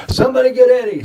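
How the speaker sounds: high, loud call for someone. Acted. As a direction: shout to summon somebody. Calls out to have Eddie fetched.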